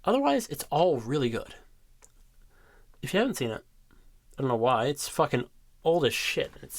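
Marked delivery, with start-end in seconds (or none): none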